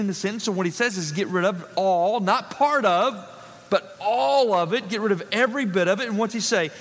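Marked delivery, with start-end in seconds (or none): 3.7-4.9 ALL drawn out slowly with weight; stress on ALL. Shown as a demonstration. Preacher explaining the Greek emphasis, stress on a drawn-out "all". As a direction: lean long and hard on "all". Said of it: "there's more emphasis on that word".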